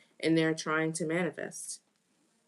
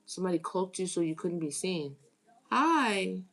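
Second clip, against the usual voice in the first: normal talking voice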